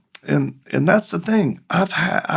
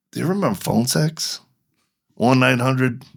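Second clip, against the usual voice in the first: ordinary talk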